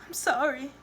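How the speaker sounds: high, small apologetic voice; embarrassed whimper. A narrator's reading. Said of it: "She lowers her head in embarrassment".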